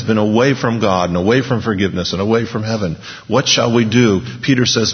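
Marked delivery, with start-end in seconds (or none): none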